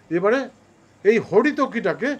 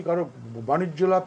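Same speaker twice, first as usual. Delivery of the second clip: ordinary talk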